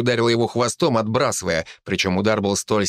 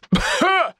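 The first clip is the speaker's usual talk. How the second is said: wet choking cough. Acted. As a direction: cough up blood. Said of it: "Ингвар кашлял чёрной кровью". (надсадный кашель)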